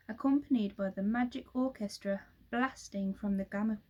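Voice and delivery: ordinary delivery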